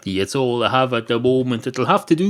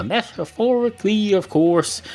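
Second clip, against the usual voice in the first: metaphorically, of course, pitched much higher; raised-pitch repeat; 8 semitones higher than usual. Shown as a demonstration. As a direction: repeat it higher, like giving a cue. Repeats the phrase as a cue.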